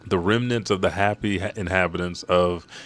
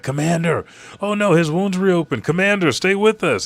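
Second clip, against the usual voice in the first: high, loud mock panic; acted alarm; 10 semitones higher, 6 dB louder than usual. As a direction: cry out to a wounded commander. Soldiers panic over wounded commander.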